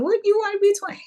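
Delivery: high, incredulous "What, you wanna be twenty?"; a high scoffing retort; mock question. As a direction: squeal it in mock disbelief. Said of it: "And then".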